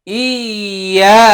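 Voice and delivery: ইয়া stretched out slowly with a rise; long held iya. Shown as a demonstration. Teacher demonstrating ইয়া slowly to students, long and drawn out. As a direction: draw ইয়া out long, rising at the end. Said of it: "লক্ষ্য করি সবাই".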